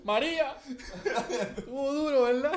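high, loud joking call; mock shout. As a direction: call out the hurricane name. Joking call across the museum. (grito agudo de broma)

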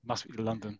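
ordinary talk, nothing marked

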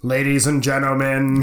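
ordinary talk, nothing marked

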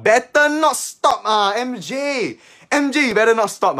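high, slow, loud plea; loud urging. Real, not acted. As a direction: urge her hard not to quit. Urging viewer MJ not to quit.

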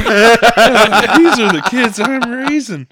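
high, loud exasperated lament; mock dismay. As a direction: wail it as a comically despairing dad. Dad's retold dismay at his kids.

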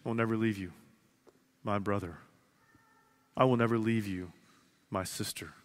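Jesus reassuring a hurting listener, tender; tender promise. Pretend. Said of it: "hear Jesus looking at you saying".

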